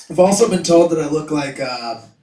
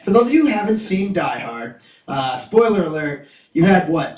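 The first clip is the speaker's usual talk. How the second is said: normal talking voice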